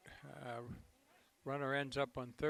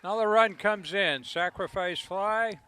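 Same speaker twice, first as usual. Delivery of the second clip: excited; 3 semitones higher, 7 dB louder than usual. Real, not acted. Play-by-play, run scores.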